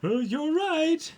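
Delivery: very high, slow cartoon squeak; squeaky minion. Minion meekly agreeing with Mama.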